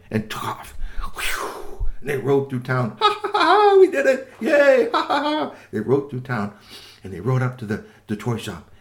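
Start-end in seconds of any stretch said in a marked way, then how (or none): 3.0-5.5 high, loud giggling cheer; gleeful laughing. Acted. Boys celebrate their escape riding through town, laughing loudly. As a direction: laugh and cheer like kids who got away.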